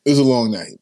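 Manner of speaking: pushed out loud from a strained voice. Real, not acted. Host telling chat about last night, said loudly. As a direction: say it out, full voice. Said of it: "my voice is".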